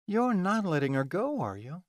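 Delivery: high-pitched disbelieving check; alarmed question. Acted. Delilah questions Amori, alarmed.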